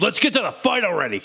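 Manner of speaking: mock-angry burly guy, high-pitched; pushy, worked-up tough-guy impression; Raphael. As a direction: imitate a hothead itching to fight. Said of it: "really angry all the time".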